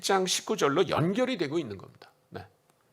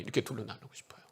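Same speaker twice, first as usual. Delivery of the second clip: whispered aside; 4 semitones lower, 10 dB quieter, faster than usual. Real, not acted. Aside after dividing Christians.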